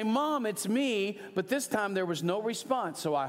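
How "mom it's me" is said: high, slow call to mom; a hint of calling out to mom. Calling out to his mother on arrival, high and slow.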